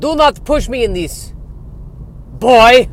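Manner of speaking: put-on high, loud, mounting warning in Dracula-style accent; angry Eastern European vampire accent. Vampire accused of faking, angry Eastern European accent. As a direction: explode on the last word in a vampire's accent. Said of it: "it's a fake accent".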